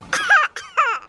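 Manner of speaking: short taunting laugh; mocking laugh. Bahu laughs at her stunned mother-in-law, a mocking laugh. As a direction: laugh at the shocked in-laws.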